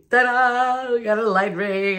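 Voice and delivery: high showy reveal. Real, not acted. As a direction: present it with a flourish. Shows off new light ring.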